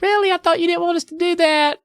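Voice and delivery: put-on high squeaky girls' voice; surprised little girls; squeaky doubtful girls. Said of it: "They're like".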